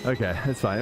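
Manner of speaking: ordinary talk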